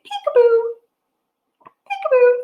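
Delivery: high, loud playful peekaboo. Pretend. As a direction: surprise a baby with it. Peekaboo game in rhyme.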